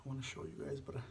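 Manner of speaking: soft mumbled eating asides; quiet murmur. Real, not acted. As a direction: murmur it close to the mic.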